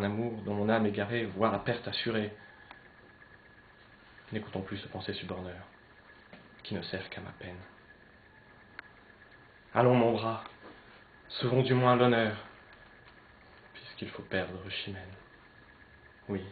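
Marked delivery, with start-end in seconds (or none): 4.3-7.6 subdued. Acted. Turns away from thoughts of death. 9.7-12.4 loud, declaimed call to arms; rally yourself out loud, theatrically; forceful heroic exhortation; rousing resolve. Acted. Rouses himself to save honour.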